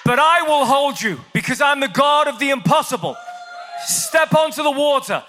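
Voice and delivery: high, loud proclamation; booming divine command. Acted. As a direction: play the Lord thundering an order. Jesus acted, commanding Peter forward.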